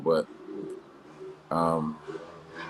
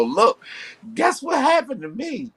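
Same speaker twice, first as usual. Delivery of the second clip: high-pitched mock gossip voice, far above her own; squeaky put-on voice, much higher; mock oversharer; slower than usual. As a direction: play the eager oversharer spilling news online. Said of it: "they just come online and go".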